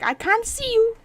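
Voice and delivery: high, loud mock complaint from a viewer; loud, high impression of a confused viewer; imitating a viewer. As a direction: squeal it like a chatter who sees nothing. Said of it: "I'm being like".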